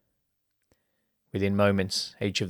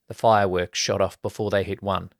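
ordinary delivery, nothing marked

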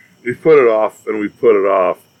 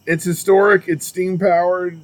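mock grand; 8 semitones higher than usual. Explains big train, mock grand.

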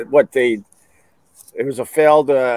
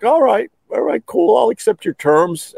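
mock easygoing Putin; 9 semitones higher than usual. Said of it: "Putin's like".

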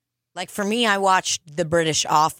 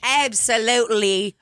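put-on Australian accent; 3 semitones higher than usual. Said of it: "with the, uh, Australian accent".

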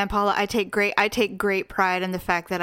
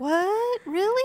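mock surprise; 10 semitones higher, slower than usual. Mock clueless self.

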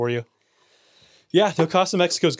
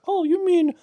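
high, slow mock mother; imitated mom, pitched way up; put-on mom voice; 14 semitones higher, slower than usual. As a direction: stretch it out in an exaggerated high mom voice. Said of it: "It's every time she talks".

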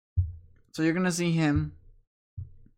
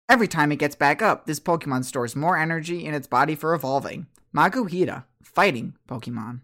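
louder, announced Pokedex entry; 5 dB louder than usual. Acted. Game master voices the Pokedex identifying Makuhita, loud announcer delivery. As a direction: read it out like a Pokedex readout. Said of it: "Dex is gonna go".

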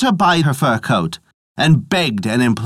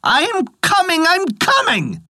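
high ringing call into the distance, without much extra volume; calling at the top of her voice. A narrator's reading. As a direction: cry it out high, as if calling while running away. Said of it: "calling at the top of her voice".

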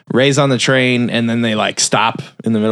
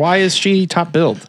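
mock indignation; 8 semitones higher than usual. Imitating an indignant complaint.

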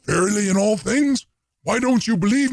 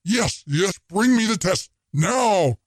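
yelled; 5 semitones higher than usual. A narrator's reading. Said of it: "yelled Cassini".

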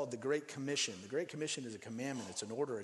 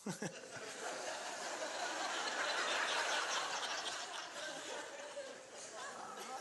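breathy high laugh; 11 semitones higher, 6 dB quieter than usual. Real, not acted. Long laugh after punchline.

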